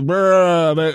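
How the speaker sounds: loud rolled "brr"; mock churned-out playing. Pianist mocking players who just reel it off, rolled brr noise. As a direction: trill out a careless blurt of notes. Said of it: "está soltando".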